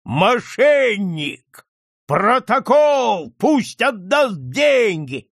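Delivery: high, loud, stretched-out cries; slow, furious accusing yells; outraged shouting. A narrator's reading. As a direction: bellow each demand at the cheat. Crowd erupts at exposed cheat.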